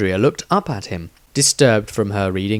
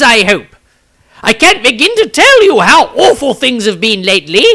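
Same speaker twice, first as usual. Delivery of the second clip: put-on much higher and louder, flustered pompous old wizard; dramatic self-important wizard; 9 dB louder than usual. Rambling wizard greets the Seer Council, high and theatrical. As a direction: gush your words like a dishevelled showman meeting legends. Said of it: "with an introduction of dramatic air and self-importance".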